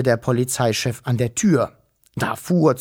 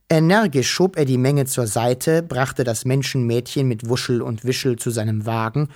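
ordinary delivery

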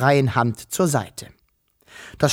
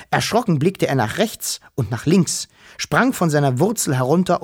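plain reading voice

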